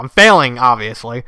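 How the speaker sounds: plain reading voice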